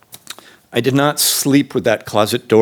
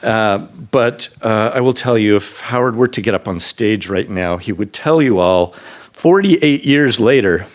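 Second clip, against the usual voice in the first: normal talking voice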